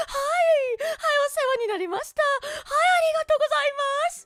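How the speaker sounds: high, overly polite Japanese housewife voice; polite Japanese wife. Pretend. Wife greeting husband's coworkers at party, high and overpolite. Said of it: "I'll go there and be like".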